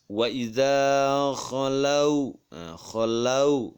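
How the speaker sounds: "khalau" slow, the au stretched into u; soft drawn-out glide. Shown as a demonstration. Teacher models a verse for children, long soft au in "khalau". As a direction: draw out "khalau", rounding off on u. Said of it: "Tuh, lembut".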